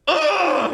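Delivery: high, loud exasperated "ugh" imitation; frustrated groan. Retelling wife's voicemail, high mocking frustrated groan. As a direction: mimic the wife's fed-up groan before hanging up. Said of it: "she was so frustrated".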